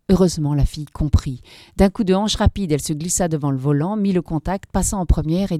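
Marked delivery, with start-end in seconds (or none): none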